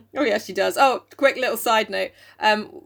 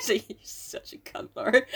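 breathless laughing aside; 5 dB quieter than usual. Pretend. Spent after laughing.

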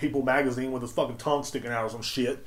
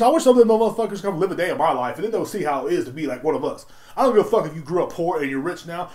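ordinary talk, nothing marked

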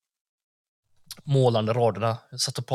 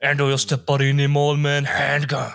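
quoting the lyric; 2 semitones higher, 4 dB louder than usual. Retold or imitated. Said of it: "som du sa på där".

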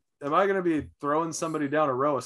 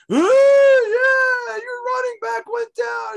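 much higher and louder, a gloating jeer at a rival fan; mocking taunt; 10 dB louder, slower than usual. Opposing fan gloating at an injured player, in a high, loud mocking jeer. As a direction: crow it like an obnoxious opposing fan.

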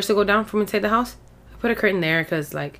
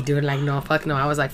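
usual speaking voice

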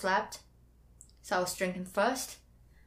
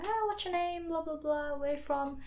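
high, sustained mock chat-up voice; imitating chat-up; 9 semitones higher than usual. German guy chatting up a girl, high mock chat-up voice. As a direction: put on a high voice for the guys.

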